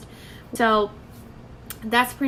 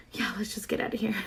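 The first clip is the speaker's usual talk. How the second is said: fast, whispered aside to mom; a quick embarrassed whisper to get out; hushed urgent whisper; 5 dB quieter, much faster than usual. Retold or imitated. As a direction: whisper it quickly so nobody hears. Wants to leave quickly, hushed.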